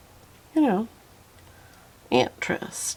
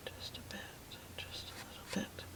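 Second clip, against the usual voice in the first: very soft whisper, a touch quicker; 13 dB quieter, much faster than usual. Real, not acted. Painter coaxing a light stroke, hushed whisper. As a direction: barely voice it, just air. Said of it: "I don't know why I'm whispering".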